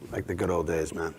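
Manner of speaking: very low, soft aside; retired officer. Retold or imitated. Retired officer approaching the actor, low and confiding. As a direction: drop deep and quiet, like a knowing old cop. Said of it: "approach me and say things like".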